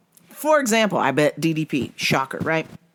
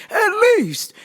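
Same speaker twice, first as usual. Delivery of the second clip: high, squawked insistence; exasperated. Real, not acted. Fan venting at the losing fighter, exasperated. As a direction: repeat it far higher, pleading in exasperation. Exasperated repeat in angry rant.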